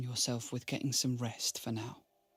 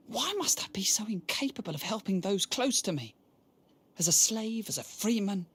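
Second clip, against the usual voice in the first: pitched up in despair; anguished; 6 semitones higher than usual. Acted. Alone after seeing his dying prince, anguished. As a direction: cry out against your own helplessness.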